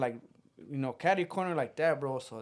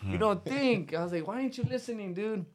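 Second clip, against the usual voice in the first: exasperated; 6 semitones higher than usual. Retold or imitated. Exasperated at cousin.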